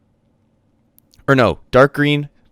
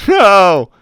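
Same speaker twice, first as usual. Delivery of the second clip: laughing no; 10 semitones higher, 11 dB louder than usual. Real, not acted. Laughing protest at Glasgow lepers.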